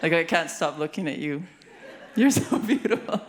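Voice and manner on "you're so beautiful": high and loud, breaking into laughter; laughing. Real, not acted. Host can't stop admiring the audience, laughing. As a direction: giggle right through the compliment. Teasing audience about good looks.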